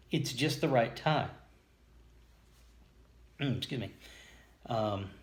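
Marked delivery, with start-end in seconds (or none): none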